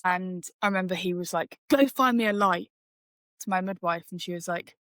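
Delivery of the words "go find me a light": lightly voiced curt doctor barking an order; rude doctor order. Retelling a rude doctor ordering the midwife about, mildly mock curt. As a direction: imitate a rude doctor's command. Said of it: "that's rude".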